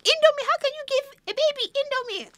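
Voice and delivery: high, loud scandalised sister voice; outraged sister. Retold or imitated. Sister scolding mother over baby noodles, shrill outraged high voice. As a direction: play the horrified big sister. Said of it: "She was like".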